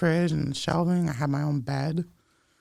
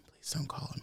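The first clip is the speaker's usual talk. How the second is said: whispered plea; 11 dB quieter than usual. Retold or imitated. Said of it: "crying and saying".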